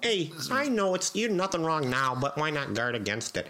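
high put-on coaxing voice; pleading team helper. Acting out handing bandages to players, high coaxing voice. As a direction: coax the players in a mock high voice.

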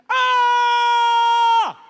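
long, high, loud yell; one sustained wordless scream at full volume; old man's yell. Retold or imitated. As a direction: hold a piercingly high yelled "ah". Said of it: "starts yelling".

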